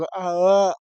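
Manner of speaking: "आ, अ" as a व attempted with lips never touching; wide-open mouth turning व into a vowel, "आ, अ"; failed open-mouthed "va". Shown as a demonstration. Teacher shows students व cannot be said open-mouthed, "आ, अ" as failed attempt. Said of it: "बिल्कुल मुंह खोल करके व बोल के देखो".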